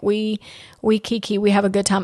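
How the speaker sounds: normal talking voice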